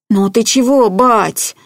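plaintive, distressed son; upset. A narrator's reading. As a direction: ask your father in wounded dismay. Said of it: "огорчённо спросил он".

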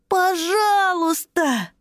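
high, slow shouted please; pleading cry. Acted. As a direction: cry out begging. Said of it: "вскричал малыш-садовод".

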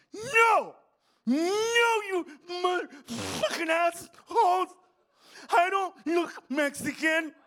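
high, wailing shout, slow and laboured; mock anguished protest, words forced out. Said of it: "he goes".

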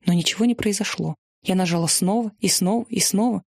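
plain reading voice

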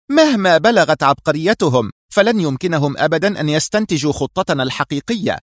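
high, emphatic sharp retort. A narrator's reading. Sonia defends their real plan to her boss, sharply. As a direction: insist sharply, hitting the opening hard. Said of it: "قالت في حدة".

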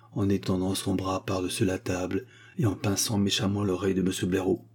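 ordinary delivery

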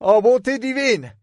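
high, carrying cry of dismay; shouted back. Acted. Pierce calling from the deck to Lorraine. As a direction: call it back across the deck. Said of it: "cria-t-il à son tour sur le pont".

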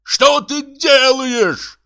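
high, drawn-out bellow; screaming high-pitched alarm; horrified yell. Acted. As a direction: yell it slowly in outrage. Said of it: "заорал Бутронца".

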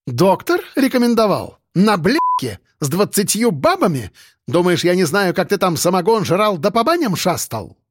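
high, outraged tirade; irate woman boss scolding; angry sarcasm. Acted. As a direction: throw his excuse back at him scornfully. Boss tears into employee.

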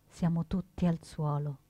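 ordinary delivery

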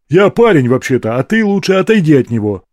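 loud, insistent declaration. A narrator's reading. Rorik warding the madam off his friend, insistent. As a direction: insist that she back off. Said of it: "настойчиво заявил Рорик".

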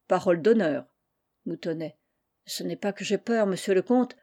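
ordinary delivery

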